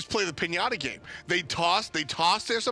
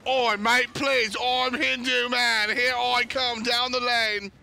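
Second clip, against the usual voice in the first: English accent impression; 5 semitones higher, slower than usual. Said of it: "That's what he sounds like".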